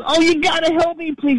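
high, strained voice of a hurt driver; high whiny begging; injured man pleading. Retold or imitated. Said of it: "He goes".

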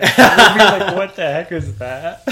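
high, loud burst through laughter; incredulous quote swallowed by laughter; laughing mock disbelief. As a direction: crack up while reacting, slowing down. Joking about funny voice recordings.

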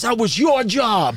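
high, drawn-out mock yell; indignant dad. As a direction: imitate an outraged father scolding his son. Dad scolds son after fight.

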